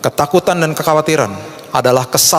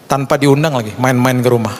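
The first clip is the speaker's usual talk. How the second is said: normal talking voice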